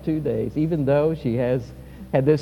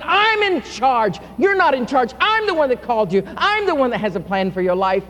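commanding Lord; 8 semitones higher, 4 dB louder than usual. Acted. Jesus speaks with authority.

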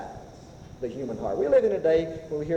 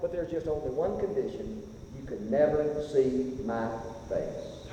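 soft and low, fading to a whisper; hushed warning in God's voice; 6 semitones lower, 7 dB quieter than usual. Acted. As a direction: lower the voice for the catch. God's bargain, hushed condition.

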